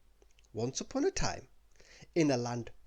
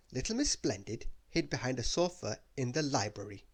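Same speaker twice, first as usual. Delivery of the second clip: usual speaking voice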